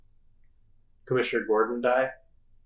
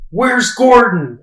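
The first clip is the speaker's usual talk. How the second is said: loud, slow, high-pitched mock demand; Batman; 11 semitones higher, 11 dB louder, much slower than usual. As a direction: call it out slowly as a hammed-up hero. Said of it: "Batman said".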